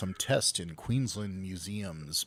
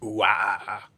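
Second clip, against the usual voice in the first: put-on Australian accent; 3 dB louder than usual. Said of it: "Never do that again, [name]".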